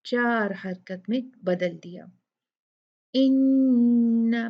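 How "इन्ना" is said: इन्ना with a nasal nn then a four-count aa; ghunna and long madd. Shown as a demonstration. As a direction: hold the nn, then stretch the aa of इन्ना. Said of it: "पहले तो दो count गुन्ना होगा". (इन्ना में गुन्ना और चार हरकत मद)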